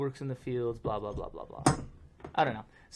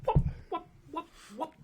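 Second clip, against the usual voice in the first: mock celebration. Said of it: "And I'm like".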